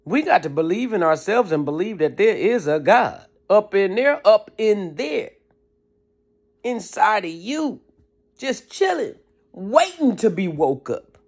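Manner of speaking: ordinary talk, nothing marked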